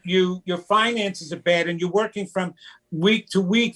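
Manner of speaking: ordinary talk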